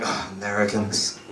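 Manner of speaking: low, muted mock groan; unimpressed Europeans. Other Europeans reacting to Americans, unimpressed. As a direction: say it like a soft, weary dismissal. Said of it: "other parts of Europe, they're like".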